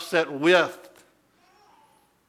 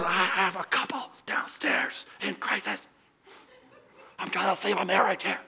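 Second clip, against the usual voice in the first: whispered scolding, urgent and hushed; angry whisper; 4 semitones higher, 4 dB quieter than usual. Retold or imitated. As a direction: hiss the scolding so downstairs can't hear. Father scolding with couple downstairs.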